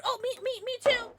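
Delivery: high, loud me-too; eager squeal. Real, not acted. Streamer joining chat for a shot, squealing eagerly.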